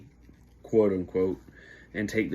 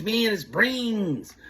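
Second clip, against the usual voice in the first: even slower and higher, eerier; faking crazy; 11 semitones higher, much slower than usual. Retold or imitated. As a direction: lean harder into the crazy act. Friend faking madness on line.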